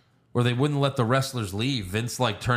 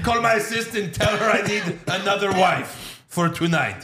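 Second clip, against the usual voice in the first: mock prince voice; 8 semitones higher than usual. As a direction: bark orders as a spoiled prince. Playing prince giving orders.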